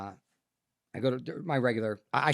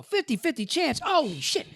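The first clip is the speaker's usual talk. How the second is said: high, stunned echo of the odds; shocked disbelief. Retold or imitated. Patient hearing the fifty-fifty odds, high shocked echo. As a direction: reel at the news. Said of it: "I go".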